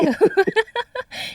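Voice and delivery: a short high giggle. Real, not acted. Host about to ask the guest a cheeky question, giggling. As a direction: giggle nervously before asking.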